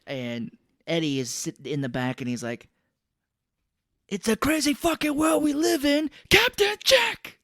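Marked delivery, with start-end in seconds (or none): 4.1-7.4 high, loud, slow stretched shout; hammed-up unhinged greeting; mock Cage howl. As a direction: stretch out Captain Jack like a madman. Cage's odd shouted line.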